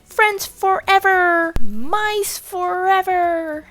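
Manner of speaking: high, slow, joyful cheer; joyful squeak. A narrator's reading. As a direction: squeak it out together. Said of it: "we squeaked".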